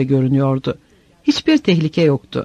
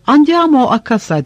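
a lightly raised, understated yell; mild narrated shout; 6 semitones higher than usual. A narrator's reading. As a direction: call it out across the road, mildly. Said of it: "diye bağırdı bir asker".

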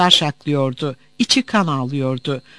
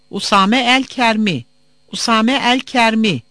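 raised, carrying roll call; calling out a name; 5 semitones higher, 4 dB louder than usual. Acted. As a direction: call a name across a waiting room. Official calls out a name. (isim anons eder gibi seslenme)

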